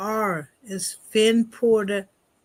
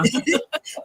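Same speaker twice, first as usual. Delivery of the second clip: laughing; 6 semitones higher than usual. Real, not acted. Laughs at prescriptions joke.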